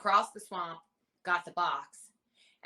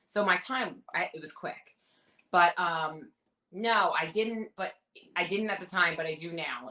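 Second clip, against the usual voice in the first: normal talking voice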